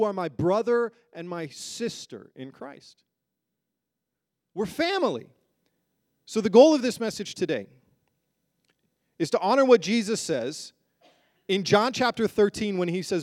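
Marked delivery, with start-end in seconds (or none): none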